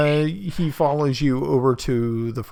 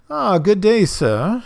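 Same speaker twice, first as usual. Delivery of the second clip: hearty greeting; 8 semitones higher, 5 dB louder than usual. Acted. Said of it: "and he says".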